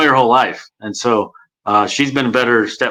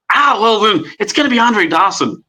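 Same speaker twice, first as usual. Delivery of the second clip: the befuddled ballpark announcer, pitched up; Harry Caray impression; 8 semitones higher than usual. Shown as a demonstration. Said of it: "my Harry Carey".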